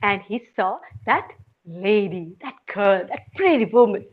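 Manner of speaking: ordinary delivery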